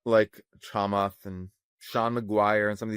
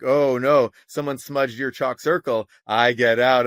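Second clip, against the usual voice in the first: mock gleeful demon; 3 semitones higher, 7 dB louder, slower than usual. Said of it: "it's like".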